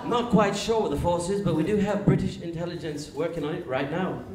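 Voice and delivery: British statesman on a phone call; Winston Churchill. Acted. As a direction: put on a pompous British accent. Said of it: "Winston calls up Roosevelt".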